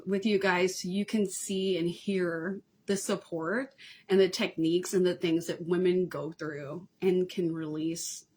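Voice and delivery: usual speaking voice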